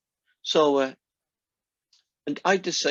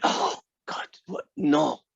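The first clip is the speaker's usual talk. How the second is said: whispered, aghast; shocked whisper; slower than usual. Retold or imitated. Mum hearing her toddler swear, shocked whisper. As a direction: gasp it under your breath in horror.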